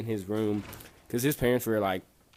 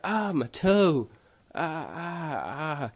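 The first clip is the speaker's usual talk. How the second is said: mock cry of pain, drawn out high; 5 semitones higher, much slower than usual. Storyteller imitating friend who hurt his toe, pained cry.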